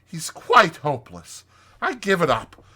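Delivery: high, loud exasperation; fed-up, emphatic Rat; snorting Rat. A narrator's reading. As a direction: give up on him indignantly. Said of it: "he snorted".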